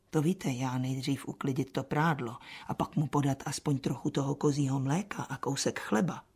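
soft low hushed voice. Acted. Martha recalling her hushed offer, soft low voice. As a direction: keep it quiet near the master.

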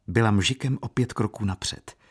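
usual speaking voice

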